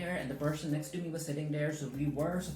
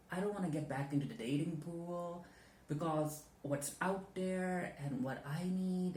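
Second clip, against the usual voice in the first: halting, softer talk to a friend about being ghosted; confiding monologue; 3 dB quieter than usual. Acted. Actor confides in scene partner about a ghosting, hesitant and hurt. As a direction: open up hesitantly, pausing for the listener's replies. Show you the monologue that I came up with.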